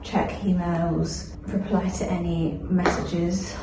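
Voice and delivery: ordinary delivery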